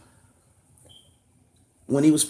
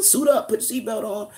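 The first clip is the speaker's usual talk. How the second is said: lightly voiced flight attendant, higher and brisk; a hint of a cabin crew announcement; mock stewardess; 9 semitones higher than usual. Streamer plays cheap-airline stewardess telling the chat to buckle up, lightly voiced. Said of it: "I'm gonna be like the stewardess".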